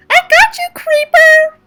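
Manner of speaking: put-on very high, loud playful girl voice; teasing girlfriend. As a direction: squeal it like a gotcha.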